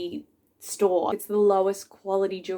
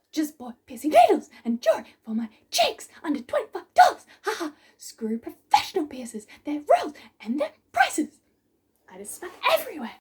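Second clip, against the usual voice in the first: mock DIY piercer; 6 semitones higher than usual. Mocking DIY piercer commenter.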